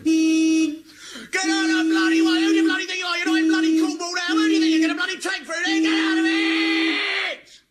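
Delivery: road rage. Acted. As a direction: bellow at the car ahead as an enraged driver. English driver's road rage rant.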